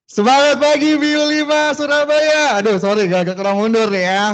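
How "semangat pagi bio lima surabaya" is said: high, loud, slow cheer to the crowd; rousing greeting. Real, not acted. New host greets the Surabaya audience with a loud rallying cheer. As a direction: fire up the audience.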